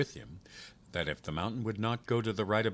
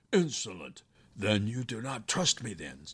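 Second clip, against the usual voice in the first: raised-voice demon outrage, falling off at the end; indignant shout; 4 semitones higher, 5 dB louder than usual. A narrator's reading. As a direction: shout it indignantly, without full force. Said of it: "shouted the demon".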